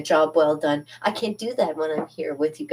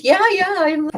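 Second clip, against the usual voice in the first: excited yeah; 14 semitones higher, 7 dB louder than usual. Retold or imitated. Retold eager reply to coworker.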